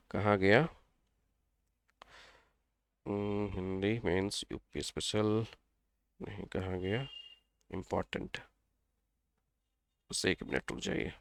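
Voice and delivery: softly, aside, searching his notes; off-mic mumble while looking for something; muttering to himself. Real, not acted. (खुद से धीमे-धीमे बुदबुदाते हुए, notes ढूँढते हुए)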